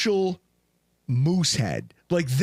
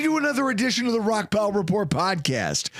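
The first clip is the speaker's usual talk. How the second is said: podcast host intro; 6 semitones higher than usual. Real, not acted. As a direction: belt out the welcome to the show. Hosts shouting show intro.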